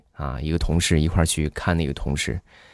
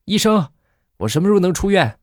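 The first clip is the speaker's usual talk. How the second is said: a high call for the doctor; a patient fed up, calling out to the doctor; crying out; 8 semitones higher than usual. Acted. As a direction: shout from the hospital bed. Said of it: "就仰起头大喊着".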